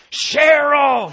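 loud, high drawn-out yell of a name; angry scream. Retold or imitated. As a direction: bellow for your wife across a crowded terminal. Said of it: "angrily scream at the top of his voice".

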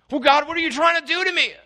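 high, fast whiny complaint; mock accusation against God. Believer in distress griping at God, high and fast. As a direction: whine it at God, offended. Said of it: "make accusations against God".

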